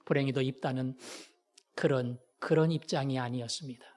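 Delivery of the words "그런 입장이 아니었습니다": ordinary talk, nothing marked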